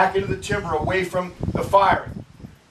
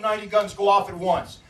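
usual speaking voice

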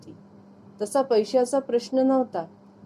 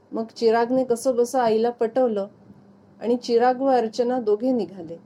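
ordinary delivery, nothing marked

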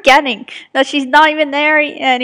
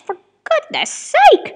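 high exasperated cry; exasperated shout; 13 semitones higher, 5 dB louder, slower than usual. Real, not acted. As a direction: throw your hands up. Reacting to long episode.

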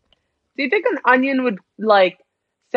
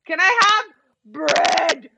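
slow, drawn-out plea, higher pitch; pleading demand; 5 semitones higher, much slower than usual. Real, not acted. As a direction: stretch out each word of the plea. Demanding food urgently in game.

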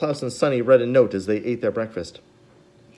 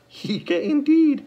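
very high, very slow mock baby word; playful echo; 4 dB louder, much slower than usual. A narrator's reading. Justice Strauss answering baby Sunny, playful high echo. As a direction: coo it back at the baby. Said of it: "looked down at Sonny and laughed".

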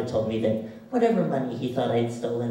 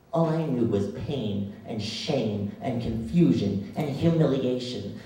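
ordinary delivery

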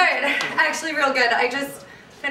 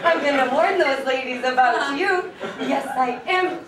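put-on gushing baby talk to a dog. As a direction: smother the dog in silly adoration. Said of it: "that annoying dog voice".

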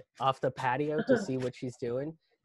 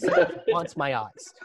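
stretched out with mock dread; slow, loud repeat; 5 dB louder, slower than usual. Man warning fiancée about rooster, slow loud dread. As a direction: drag each word out, louder.

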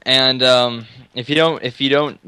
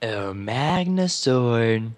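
slow drawn out; 2 semitones lower, 5 dB quieter, much slower than usual. Pretend. Echoing slowly.

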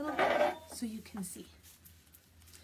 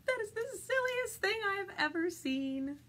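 high-pitched storybook cow; cow's disbelieving thought; 10 semitones higher than usual. Acted.

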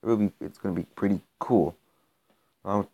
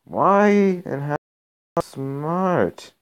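higher and slower, drawn-out amazement; stunned disbelief; 7 semitones higher, 3 dB louder, much slower than usual. Real, not acted. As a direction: ask it like you truly can't believe it. Baffled by strong level-one opponent.

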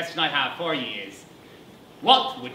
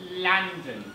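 normal talking voice

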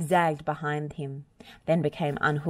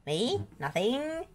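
innocent; 7 semitones higher, 4 dB quieter, slower than usual. A narrator's reading. Scarecrow plays innocent.

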